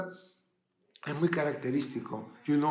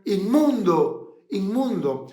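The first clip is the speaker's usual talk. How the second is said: higher, louder, drawn-out warning cry; grito de leproso; 4 semitones higher, 6 dB louder, slower than usual. Acted. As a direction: call it out slowly as a warning. (grito de advertencia, alargado)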